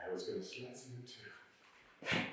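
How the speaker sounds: very low, very soft murmur; muttered aside. Acted. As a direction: drop right down to a near-inaudible mutter. Drops to a muttered echo.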